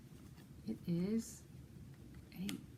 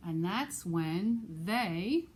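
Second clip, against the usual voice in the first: slow drawn-out build, louder; suspense; 5 dB louder, much slower than usual. Acted. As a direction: stretch it out before the reveal. Building suspense before the hug.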